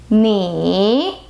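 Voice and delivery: 你 dipping to the bottom of the range and rising; third tone. Shown as a demonstration. Teacher demonstrates the third tone, low dip then rise. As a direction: sink as low as possible on 你, then lift. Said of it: "is the third tone".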